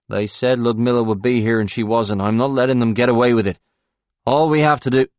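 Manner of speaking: loud, fast indignant rant. Acted. Paul insists angrily.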